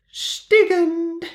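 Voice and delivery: very high, squeaky laughing duck; giggling duck. A narrator's reading. Duck denies speaking, very high and giggly.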